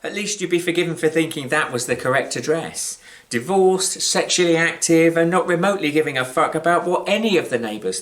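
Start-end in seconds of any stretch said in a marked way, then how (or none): none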